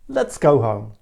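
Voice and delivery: boss. Shown as a demonstration. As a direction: say it as the boss, firmly. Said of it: "So a boss says".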